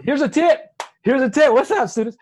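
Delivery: high-pitched mock address to listeners; hammed-up hailing of students; playful shout-out. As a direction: toss out tips in a put-on high voice.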